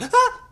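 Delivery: very high, short yelp; man's scream. Acted. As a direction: let out a sudden high-pitched mock scream. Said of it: "seen a dude scream? Like".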